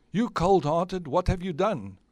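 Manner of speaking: high, loud acted outrage; outraged accusation. Hero confronting the cold-hearted witch, acted outrage.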